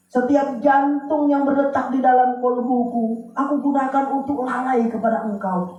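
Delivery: high, pleading cry to God; pleading tearful prayer. Retold or imitated. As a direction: beg for forgiveness like a sinner weeping. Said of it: "Terus tiap malam nangis". (doa memohon ampun, suara tinggi memelas)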